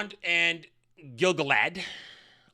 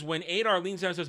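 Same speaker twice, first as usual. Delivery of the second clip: ordinary talk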